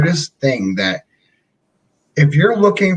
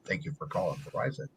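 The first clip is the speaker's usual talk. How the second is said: much lower and softer, fast rep greeting; Verizon voice; 9 semitones lower, 19 dB quieter than usual. Retold or imitated. Showing off his Verizon phone voice, low and soft. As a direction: purr the company greeting into the phone. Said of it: "don't mess with my Verizon voice".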